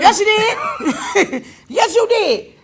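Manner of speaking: high, drawn-out laughing contradiction; playful insistence. Real, not acted. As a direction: insist teasingly through laughter. Playful insistence over a denial.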